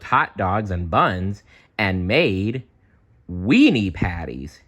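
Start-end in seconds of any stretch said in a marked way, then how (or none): none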